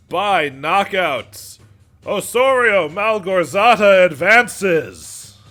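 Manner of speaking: slow, loud, drawn-out ring announcer call; arena announcer. Acted. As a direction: stretch each word out to the whole crowd. Announcer declares winner.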